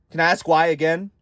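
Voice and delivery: ordinary delivery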